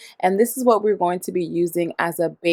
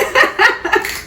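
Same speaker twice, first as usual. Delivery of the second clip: breathy laugh. Real, not acted. Laughs at her own delivery.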